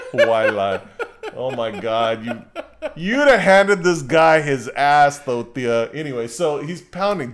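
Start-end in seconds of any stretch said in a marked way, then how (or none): none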